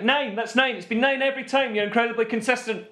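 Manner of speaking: high, hammed-up congratulation; mock crowd praise. Friend imagining fans praising the ref's count, mock delight. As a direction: praise the ref as if truly amazed. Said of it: "what I would have liked".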